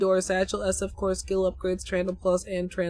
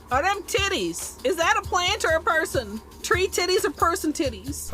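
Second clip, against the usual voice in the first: amused disbelief; 7 semitones higher than usual. Real, not acted. Streamer reacting to a picture.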